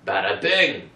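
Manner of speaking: loud, hammed-up New York exclamation; mock New York accent. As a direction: punch it out like a stock New Yorker. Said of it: "sounds identical to a New York accent".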